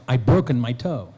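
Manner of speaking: ordinary talk, nothing marked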